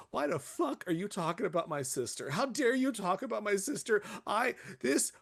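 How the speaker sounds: high mock-furious rant, voice climbing; imitated outburst. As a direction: play the guest losing it over the sauce. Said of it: "She goes".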